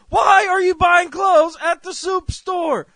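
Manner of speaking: very high, slow full-volume yell; screaming caller. Acted. Caller to shopper at the soup store, hammed-up screaming fury.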